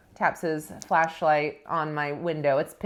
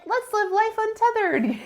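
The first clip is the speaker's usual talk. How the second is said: very high, giddy retold elation; drumming high. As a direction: sing out high, as if carried away. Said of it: "on this drumming high".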